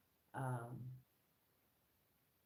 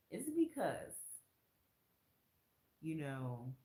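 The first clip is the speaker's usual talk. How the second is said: ordinary talk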